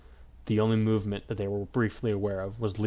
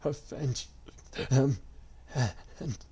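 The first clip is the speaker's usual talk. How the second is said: faint dying whisper; 5 semitones higher, 6 dB quieter, much slower than usual. A narrator's reading. Dying last request.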